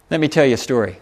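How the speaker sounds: ordinary delivery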